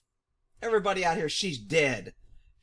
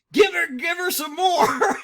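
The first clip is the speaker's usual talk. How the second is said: louder, higher egging-on shout; cheering yell; 7 semitones higher, 5 dB louder than usual. Retold or imitated. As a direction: yell it like a rowdy fan egging them on. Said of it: "patriot was like".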